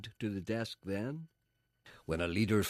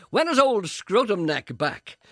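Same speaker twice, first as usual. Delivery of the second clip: high, loud, slow jeering question; rude caller; 11 semitones higher, 10 dB louder, much slower than usual. Retold or imitated. A listener phoning in to mock the presenter, loud and slow. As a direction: jeer down the phone. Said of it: "Punter rang up and said".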